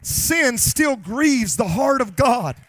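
normal talking voice

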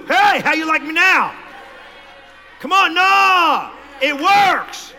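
loud high boast; triumphant shout. Real, not acted. Pastor boasting to the crowd about his cars, shouting.

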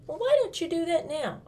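high, bright-eyed asking; high eager kid asking why not now; eager child question. Acted. Child asks brother eagerly.

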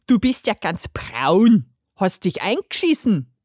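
langsam lallende Trinkerstimme; schwerfällig wie betrunken; lallend betrunken. A narrator's reading. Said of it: "lallte eine dunkle Rohrflöte". (lallende Rohrflöte)

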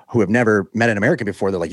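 normal talking voice

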